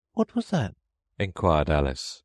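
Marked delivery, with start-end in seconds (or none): 0.1-0.8 put-on high, polite little girl; young girl voice. A narrator's reading. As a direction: lift into a prim young girl. Alice voiced by narrator.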